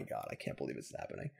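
breathy whispered disbelief; despairing whisper. Real, not acted. Gamer dismayed at his own misplay, whispering. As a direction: say it under your breath in dismay. Despair over lost game.

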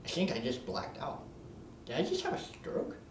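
hushed, half-whispered confusion; dazed mumble. Acted. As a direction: mutter as if coming to after blacking out.

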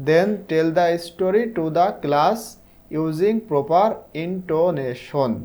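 drawn-out, careful dictation pace; slow reading aloud. Shown as a demonstration. Teacher reading the task to sixth graders, very slow. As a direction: read it word by word for beginners.

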